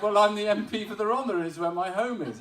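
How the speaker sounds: loud, high-pitched protest of identity; insistent self-quote. Retold or imitated. MP telling a doubting lad who he is, insistent. Retold argument over his identity.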